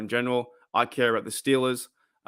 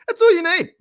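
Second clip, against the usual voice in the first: squeaked out much higher and louder; giddy; 8 dB louder than usual. Real, not acted. Streamer gushing about jersey-wearing fans, giddy high squeal.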